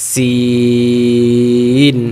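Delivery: সিন held long with a thin hiss; thin long Sin. Shown as a demonstration. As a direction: stretch সিন thin, not শিন. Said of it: "শিন বলা যাবে না". (চিকন করে লম্বা সিন)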